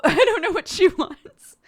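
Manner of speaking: high, loud laughing I don't know; giggly helpless quote; laughing exasperation. Retold or imitated. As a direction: imitate a baffled husband while laughing.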